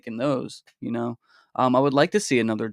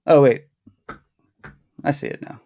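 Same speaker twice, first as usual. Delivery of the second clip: usual speaking voice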